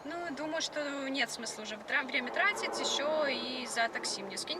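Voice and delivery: ordinary talk, nothing marked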